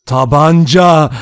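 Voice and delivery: loud, drawn-out exclamation; crying out. A narrator's reading. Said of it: "diye haykırdı Bloor". (uzatarak haykırma)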